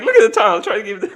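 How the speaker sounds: high imitation breaking into laughter; mock-casual quote. Streamer mocking anchor staring straight ahead, laughing mock-casual quote. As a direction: mimic him, laughing by the end. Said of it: "He just looks straight ahead. He's like".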